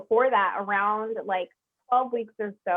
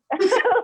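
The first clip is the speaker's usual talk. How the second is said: loud scoffing laugh; 11 semitones higher, 7 dB louder than usual. Real, not acted. Scoffs at going back there.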